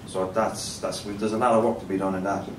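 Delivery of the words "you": ordinary talk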